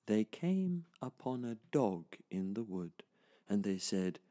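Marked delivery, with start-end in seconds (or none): none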